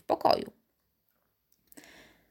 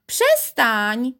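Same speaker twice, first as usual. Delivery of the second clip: sharp, higher protest; shouting; 4 semitones higher, 4 dB louder than usual. A narrator's reading. Piotr tugged by someone unseen, shouting. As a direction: cry out to make it stop. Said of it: "krzyknął".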